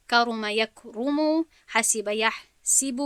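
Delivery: slow model forms, "ru" in یکرم and "si" in یحسب drawn out; stressed middle vowel. Shown as a demonstration. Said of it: "و همین خل هم مثلاً".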